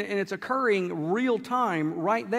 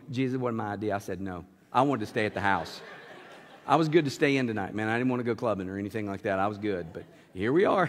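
a hint of embarrassed pleading; sheepish [name]; 11 semitones lower than usual. [name] apologizing to Jesus, low and sheepish.

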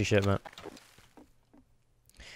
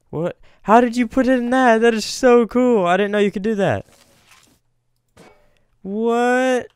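high, loud amazed exclamation; 12 semitones higher, 5 dB louder than usual. Real, not acted. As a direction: squeal with amazement. Amazed at teammate's trick.

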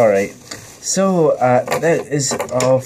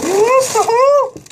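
shrill surprise; 4 dB louder than usual. Real, not acted. Shocked squeal at friend.

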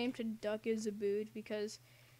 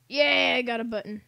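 excited cheer; 2 semitones higher, 15 dB louder than usual. Real, not acted. Gamer celebrating finding a button, excited loud cheer. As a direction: cheer loudly at a small win.

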